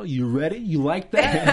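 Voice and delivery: mock presenter pillow talk; chirpy host voice, played for laughs; TV-host sex talk. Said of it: "what his sex talk would be like".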